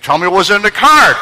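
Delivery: high, loud impatient plea; retold eager demand. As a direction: demand to know, voice jumping up. Acts out own eager demand.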